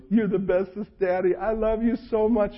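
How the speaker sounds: imitating small daughter, sweet and high; sweet little girl. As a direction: gush like a six-year-old buttering up dad. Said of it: "and goes".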